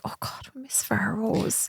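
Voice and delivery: soft, slow breathy whisper; hushed, longing fan whisper; wistful fan. Retold or imitated. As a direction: sigh it out like a fan missing her. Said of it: "followers that are like".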